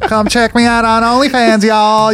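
put-on Southern accent. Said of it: "with that accent".

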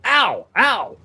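high acted "ow" of pain; pained yelps. Injured monk having his head bandaged, yelping in mock pain. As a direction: yelp as if a sore head is being handled. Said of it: "he keeps going".

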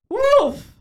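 high, loud cry of wolf. Acted. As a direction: cry out like a shepherdess raising the alarm. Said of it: "and she cries out".

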